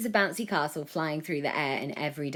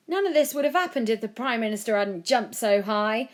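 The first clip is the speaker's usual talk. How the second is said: higher, louder blaming yell; angry yell; 6 semitones higher, 6 dB louder than usual. A narrator's reading. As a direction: yell the blame at him. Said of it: "one man yelled".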